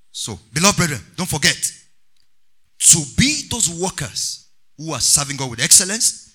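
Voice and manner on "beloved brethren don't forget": ordinary talk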